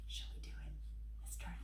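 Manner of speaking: conspiratorial whisper. Acted. Reader invites the children to turn the page, whispering. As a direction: whisper it like a secret plan. Aside to kids, hushed.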